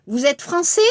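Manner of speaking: "français?" rising at the end; rising question. Shown as a demonstration. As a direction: let the voice climb on "français?". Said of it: "억양 et 질문".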